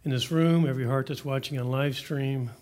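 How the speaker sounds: soft, earnest pastor's prayer. Acted. Pastor praying over the hearts in the room, soft and earnest. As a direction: pray over the room, unhurried and hushed.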